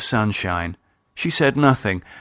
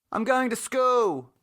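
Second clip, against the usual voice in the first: put-on much higher, distant girl's voice; called from inside; teen daughter; 13 semitones higher, 4 dB quieter than usual. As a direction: teenager calling out.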